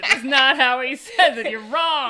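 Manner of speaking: loud, laughing objection; amused, emphatic contradiction; laughing protest. Real, not acted. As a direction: insist through your laughter. Laughing protest at friend's impression.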